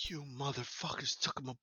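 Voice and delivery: restrained mock fury before the outburst; mad captive. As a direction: curse them under the volume. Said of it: "he's just so mad".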